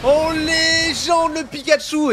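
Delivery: excited casting. Real, not acted. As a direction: build the hype on the kills. Caster hyping a multi-kill. (commentaire survolté)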